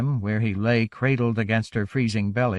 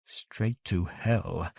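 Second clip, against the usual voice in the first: hushed and slow, as if to himself; inner thought; 5 semitones lower, 9 dB quieter, much slower than usual. A narrator's reading. Theo watches the child burn, a hushed slow thought. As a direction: let it fall soft and drawn out. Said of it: "Theo thought".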